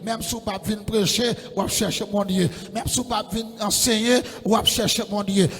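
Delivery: loud, rising sermon climax; full-force preaching. Real, not acted. Preacher urging the congregation to seek God, loudly.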